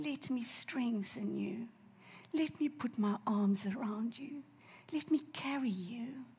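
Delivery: soft, slow, gentle reassurance; tender comfort. Acted. Preacher voices Jesus comforting the brokenhearted, tender and hushed. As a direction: comfort someone who is grieving, almost hushed.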